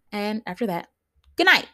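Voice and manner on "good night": sassy dismissal. Acted. Dismissing men after use.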